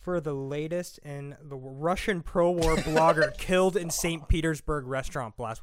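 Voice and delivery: slow, measured newsreader delivery; mock news read. Host springing grim news on his co-host, mock newscast. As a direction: read the headline like an anchor. Reads headlines for mock segment.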